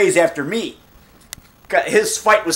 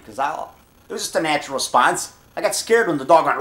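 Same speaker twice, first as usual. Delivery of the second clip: ordinary talk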